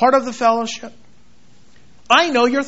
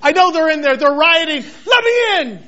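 rising, louder eager plea; preacher voicing Paul, eager and much louder; 5 semitones higher, 4 dB louder than usual. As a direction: play Paul begging to be let in to the rioters. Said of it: "that's why he was able to say".

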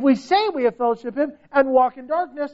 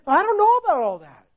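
higher, quick doubtful reply; lightly voiced hesitant listener; 7 semitones higher, faster than usual. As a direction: lightly play a churchgoer backing off. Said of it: "or could you say".

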